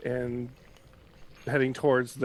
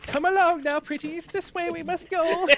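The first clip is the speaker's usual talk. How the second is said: high cackling witch voice; 6 dB louder than usual. Acted. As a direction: play it like the Wicked Witch of the West. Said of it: "I wanna hear your impression of Pamela's voice".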